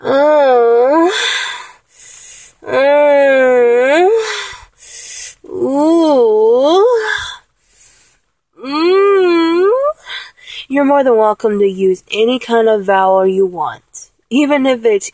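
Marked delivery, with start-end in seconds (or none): 0.0-9.7 wandering pitch moans. Shown as a demonstration. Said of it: "just do this".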